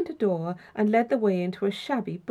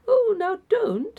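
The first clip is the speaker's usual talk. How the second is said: high, drawn-out gentle protest; old gentleman urging a guest to stay; protesting elderly host; 13 semitones higher, 4 dB louder, slower than usual. A narrator's reading. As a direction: plead kindly, pitched well up and slow. Host stops guest from leaving.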